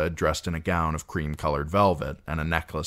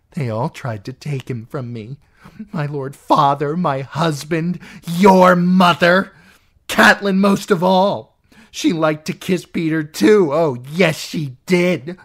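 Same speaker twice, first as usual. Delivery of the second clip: spiteful jealousy; a sister raving about Catelyn; bitter, raving aunt; 11 semitones higher, 10 dB louder than usual. Acted.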